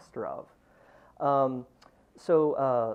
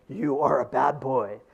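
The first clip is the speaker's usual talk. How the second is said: slow, louder scolding voice; the other voice scolds, harder and louder; bad boy voice; 9 dB louder, slower than usual. The opposing video voice scolds the boy, slow and louder. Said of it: "this voice over here says".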